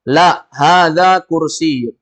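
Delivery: slow, drawn-out teacher's model line; teacher drilling Arabic phrase slowly. Shown as a demonstration. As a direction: say it slowly for the class to repeat. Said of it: "Semuanya ikuti".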